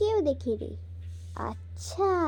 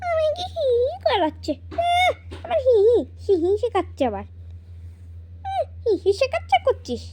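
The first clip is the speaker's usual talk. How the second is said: higher, teasing laughter-laced lines; mocking giggle; 6 semitones higher than usual. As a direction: giggle while mocking her. Mocking giggles between siblings.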